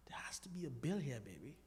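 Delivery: hushed, unvoiced aside; whisper. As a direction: whisper it so nobody hears. Joking aside to wife.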